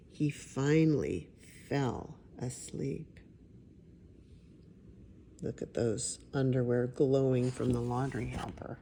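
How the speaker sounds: plain reading voice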